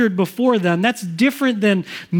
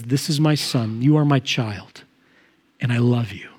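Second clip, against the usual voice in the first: tender father's words; 7 semitones lower than usual. Acted. Father's voice speaking to his son.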